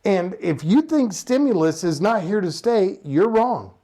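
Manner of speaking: ordinary talk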